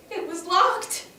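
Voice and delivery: acted distress. Survivor finding the escape door shut, distressed. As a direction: let the realisation hit. First realisation at the locked door.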